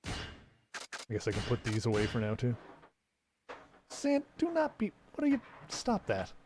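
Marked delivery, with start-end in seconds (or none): 3.9-6.3 squeaky scolding. Real, not acted. Scolding the cat.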